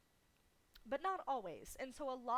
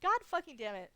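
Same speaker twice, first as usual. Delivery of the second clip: laughing; 8 semitones higher, 14 dB louder than usual. Real, not acted.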